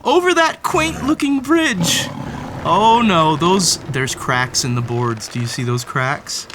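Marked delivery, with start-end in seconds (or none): none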